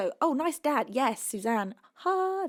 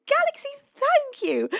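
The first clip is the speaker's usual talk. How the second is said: excited; 4 dB louder than usual. Real, not acted. Thrilled thanks to donor.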